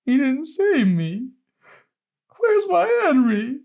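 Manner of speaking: put-on a frantic old woman crying out for Henry; desperate grandmother. A narrator's reading. Grandma begging her grandson for Henry, high, slow and desperate. Grandma pleads for dead husband.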